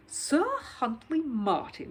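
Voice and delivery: slow, grand reading of the name; grandly read name. Acted. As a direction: announce it slowly like a herald.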